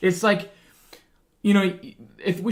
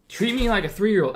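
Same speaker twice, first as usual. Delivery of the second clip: ordinary talk, nothing marked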